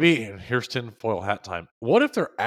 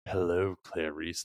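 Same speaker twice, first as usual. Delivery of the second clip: low, slow, soft creepy greeting; 5 semitones lower, 6 dB quieter than usual. Retold or imitated. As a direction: draw it out low and softly, like a creepy movie killer. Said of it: "in that creepy voice".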